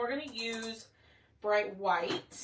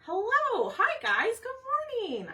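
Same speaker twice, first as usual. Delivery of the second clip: excited greeting; 12 semitones higher, 4 dB louder, slower than usual. Real, not acted. Greeting viewers joining the live.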